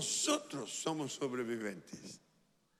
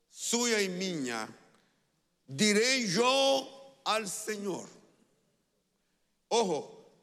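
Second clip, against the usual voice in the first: loud, high, rousing preacher voice; rally the congregation into declaring it aloud; full-force preaching; 7 semitones higher, 8 dB louder than usual. Real, not acted.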